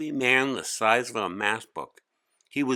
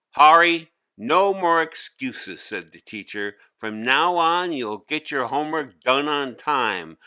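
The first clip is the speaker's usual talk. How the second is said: much louder, higher stern teacher laying down the law; firm scolding; 6 semitones higher, 7 dB louder than usual. Acted. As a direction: shut down the excuses with a loud scolding. Teacher cuts off excuses.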